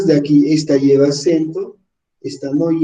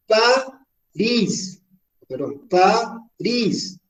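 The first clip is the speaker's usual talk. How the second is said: París split slowly, RÍS held; París in two slow beats, stressed at the end; syllable by syllable; 6 semitones higher, much slower than usual. Shown as a demonstration. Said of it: "Fuerza de entonación en la última".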